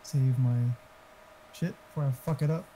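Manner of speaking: ordinary talk, nothing marked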